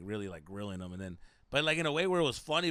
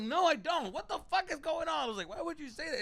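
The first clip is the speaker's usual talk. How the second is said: incredulous irritation. Retold or imitated. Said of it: "I'm like".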